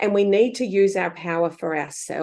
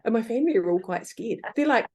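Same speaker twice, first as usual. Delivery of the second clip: ordinary delivery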